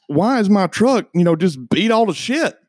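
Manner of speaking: high, loud indignant demand; retold angry yell. Angry owner confronting the mechanic about the truck, high, loud yell.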